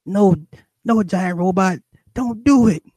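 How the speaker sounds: put-on shrill kid crying out to his robot; little boy pleading. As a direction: beg like a frightened little kid. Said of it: "And the little boy said".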